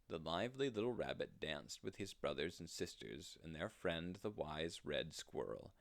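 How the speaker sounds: ordinary delivery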